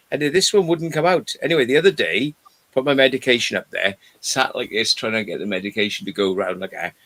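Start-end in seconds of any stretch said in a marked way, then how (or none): none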